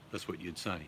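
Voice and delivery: usual speaking voice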